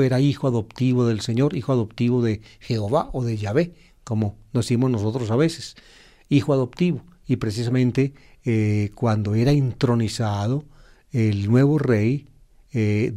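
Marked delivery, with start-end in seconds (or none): none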